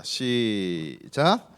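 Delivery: long, low, stretched start signal; drawn-out cue. Real, not acted. Pastor cues children to read together, drawn-out cue. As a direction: draw the word out to cue a group.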